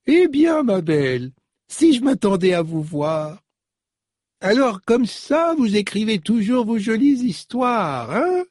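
put-on very high, cracked old lady voice, fond and teasing; an old nanny chatting to her grown girl; chatty old woman. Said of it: "tout éraillée par la vieillesse".

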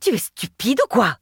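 strained, scornful indignation; indignant. A narrator's reading. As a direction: throw it back in outrage. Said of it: "s'indigna-t-elle".

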